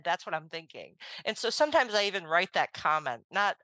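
ordinary talk, nothing marked

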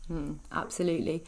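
normal talking voice

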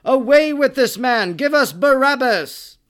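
high, loud unanimous mob shout; crowd shout. A narrator's reading. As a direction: yell it as a whole angry crowd. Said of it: "one unanimous shout".

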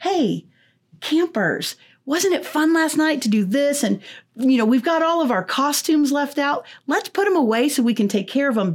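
high, bright camp counselor cheer; upbeat encouraging. Pretend. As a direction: coax the kids kindly, all smiles. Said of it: "It needs to be".

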